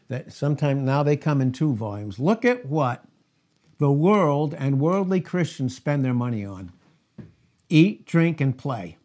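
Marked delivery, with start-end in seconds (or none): none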